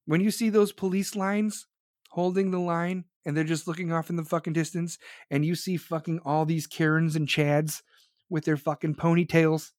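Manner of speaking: normal talking voice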